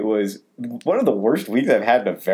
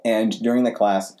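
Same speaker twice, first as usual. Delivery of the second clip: ordinary delivery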